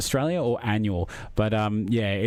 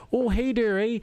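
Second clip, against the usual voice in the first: high, bright mock Canadian voice, eh; hammy attempt at a Canadian accent; playful Canadian accent for a viewer in a tux; 9 semitones higher than usual. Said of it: "I can't really do much of a Canadian accent".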